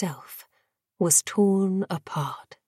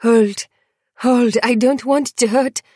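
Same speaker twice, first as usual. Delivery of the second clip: higher, urgent plea; pleading kennel boy, raised and desperate; 5 semitones higher, 5 dB louder than usual. A narrator's reading. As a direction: beg him to stop, pitched up. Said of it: "Joaquin pleading".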